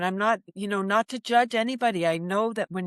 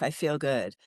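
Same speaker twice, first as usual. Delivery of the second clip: usual speaking voice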